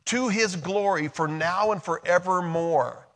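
loud, high, ringing preacher's build; preaching at full force. Acted. Pastor proclaims to the congregation, preaching loud and high. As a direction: lift the whole room with it.

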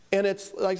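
normal talking voice

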